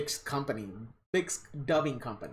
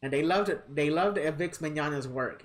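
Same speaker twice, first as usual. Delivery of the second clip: ordinary talk, nothing marked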